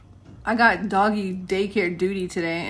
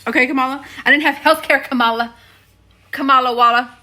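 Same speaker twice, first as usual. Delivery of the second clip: louder, higher jokey scolding of Kamala; joking rant at Kamala about healthcare; mock call-out; 4 semitones higher, 8 dB louder than usual. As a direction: tease her like a complaint letter. Mock call-out, then just kidding.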